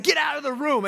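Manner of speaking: high sharp mock shout at the boy; retold snap at son. A father yelling at his son to get out, mock shout. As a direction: bark it out, half-playful. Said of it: "I said".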